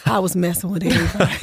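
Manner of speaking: quick, laughing through the words; laughing quote. Retold or imitated. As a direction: laugh while giving his confession. Son's confession, she laughs.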